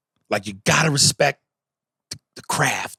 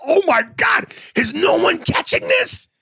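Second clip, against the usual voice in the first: incredulous outcry. Retold or imitated. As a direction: shout it in disbelief at nobody noticing. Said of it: "I was like".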